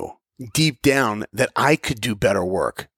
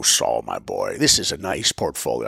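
put-on much lower, kindly old animator voice; Bill Matthews impression; 12 semitones lower than usual. Recalling the Disney animator praising his portfolio, low and kindly. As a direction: drop low to imitate a cool older mentor. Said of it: "I remember his voice".

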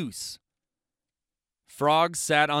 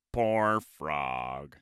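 much lower, sympathetic Toad; pitying Toad; 12 semitones lower, 3 dB quieter than usual. Acted. Toad seeing Frog alone, low pity. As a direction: sigh it as a sorry Toad.

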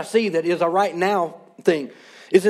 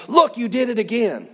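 higher-pitched mock accusation; acted reprimand, pitched up; heavy-handed scolding; 4 semitones higher than usual. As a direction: come down hard, as if catching a repeat offender. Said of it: "come in with a heavy hand and be like".

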